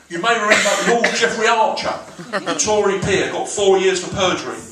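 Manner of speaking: ordinary talk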